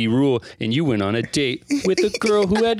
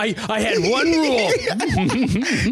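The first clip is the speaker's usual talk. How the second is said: laughing; 10 semitones higher, much slower than usual. Real, not acted. Laughing mock complaint.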